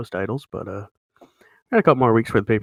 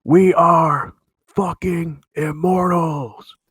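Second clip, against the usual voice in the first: drawn-out, loud, higher-pitched proclamation; triumphant yell; 6 semitones higher, 6 dB louder, much slower than usual. Acted. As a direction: bellow it slowly like a champion in the ring. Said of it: "and yells".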